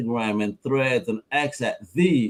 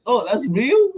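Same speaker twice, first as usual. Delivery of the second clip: surprised; 10 semitones higher, 3 dB louder than usual. Retold or imitated.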